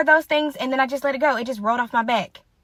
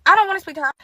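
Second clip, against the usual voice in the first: higher-pitched imitated huff; petulant refusal; 6 semitones higher, 4 dB louder, faster than usual. Friend refusing to greet mom, petulant high voice.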